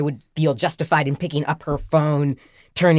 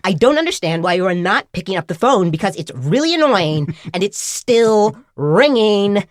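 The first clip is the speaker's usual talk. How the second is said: mimicked nag rising higher and louder; irritated ringtone voice; 5 semitones higher, 4 dB louder than usual. Phone scolding a stranger who won't answer, mounting irritation. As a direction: stretch the end into an exasperated whine. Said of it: "getting more and more irritated with me".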